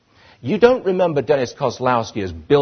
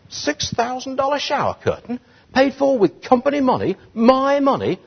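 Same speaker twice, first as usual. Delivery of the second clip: mock outrage; 10 semitones higher than usual.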